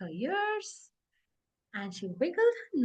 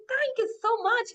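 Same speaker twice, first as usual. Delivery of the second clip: put-on high, excited child voice; excited thanks; 9 semitones higher, 4 dB louder than usual. Boo Boo thanking the little birdie, excited. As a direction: gush it high with delight. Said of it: "Boo Boo was so excited".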